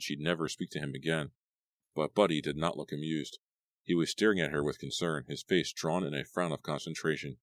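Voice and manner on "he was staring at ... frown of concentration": ordinary delivery, nothing marked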